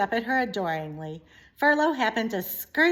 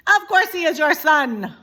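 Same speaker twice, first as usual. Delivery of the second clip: higher, louder indignant wife; French mother mouse; 8 semitones higher, 11 dB louder than usual. Acted. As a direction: scold him with French flair. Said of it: "said Antoinette".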